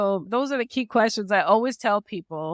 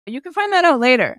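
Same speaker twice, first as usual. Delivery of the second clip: usual speaking voice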